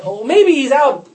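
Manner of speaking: high, loud mock-innocent teasing; playful jeering, breaking into a chuckle; [name]. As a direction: taunt them with amused suggestions. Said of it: "it was such a riot for [name]".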